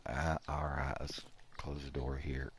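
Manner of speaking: soft unvoiced whisper. Real, not acted. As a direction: whisper it so nobody hears. Sneaking into the trader's room.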